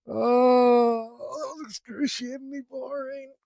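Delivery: high, slow, drawn-out complaint; exaggerated whine. Real, not acted. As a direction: groan it out like pure torture. Whining after tedious section.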